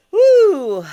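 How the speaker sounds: high, very loud "woo"; loud whoop. Real, not acted. Vlogger resetting before the name story, high whoop. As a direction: let out a big high whoop.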